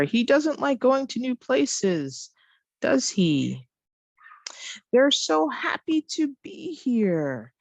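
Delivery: statement pitched high and drawn out, tag dropping; modelled example sentences. Shown as a demonstration. Teacher reads the matched answers aloud, modelled example sentences. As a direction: read it high and slow, then let each tag fall.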